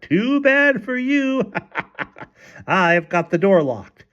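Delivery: high, slow sing-out with a mocking laugh; rude, taunting call. A narrator's reading. Husband behind the locked bathroom door, taunting rudely. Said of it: "laughed rudely and called out".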